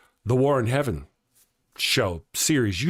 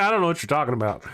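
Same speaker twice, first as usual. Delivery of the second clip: ordinary talk, nothing marked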